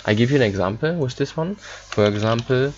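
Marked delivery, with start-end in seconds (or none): none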